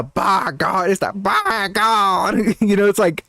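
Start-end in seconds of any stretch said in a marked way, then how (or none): 1.1-2.4 BY GOD pitched higher in a mimicked commentator cry; up a couple registers. Shown as a demonstration. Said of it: "up a couple registers".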